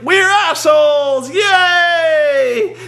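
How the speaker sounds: high, loud hammed-up cheer; mock crowd shout. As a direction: cheer it like a dim happy crowd.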